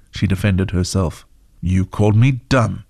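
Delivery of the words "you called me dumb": plain reading voice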